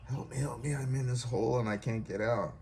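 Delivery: a mock cry for rescue; retold plea for help. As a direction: call up from the hole, lightly acted. Trapped man begs passerby for help.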